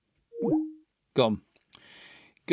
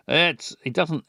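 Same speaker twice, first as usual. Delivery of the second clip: normal talking voice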